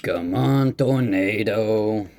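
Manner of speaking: playful holler to a tornado; calling out. Pretend. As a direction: coax the storm over, stretching the word.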